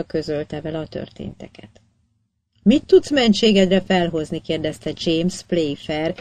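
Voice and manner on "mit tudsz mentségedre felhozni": barely held irritation. A narrator's reading. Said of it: "nehezen fékezve ingerültségét".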